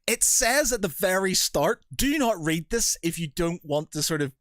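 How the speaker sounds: high, pointed exasperation; exasperated retort. Retold or imitated. Host replying to an annoyed reader, high exasperation. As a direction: point at the warning they ignored. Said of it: "I was just like".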